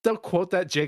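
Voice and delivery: ordinary talk, nothing marked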